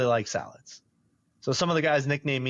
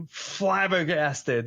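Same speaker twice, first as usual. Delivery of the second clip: ordinary talk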